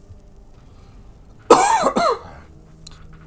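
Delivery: loud coughing fit; harsh cough. Real, not acted. Sorry about that.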